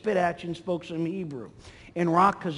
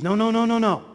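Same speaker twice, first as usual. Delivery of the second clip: raised, louder string of protests; acted urgent refusal; 4 semitones higher, 5 dB louder, faster than usual. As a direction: protest quickly on a higher pitch. Centurion hastily refusing Jesus's offer.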